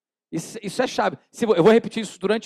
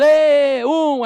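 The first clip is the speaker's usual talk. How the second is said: mock crowd jeer; 5 semitones higher, 6 dB louder than usual. Crowd jeering at prank victim.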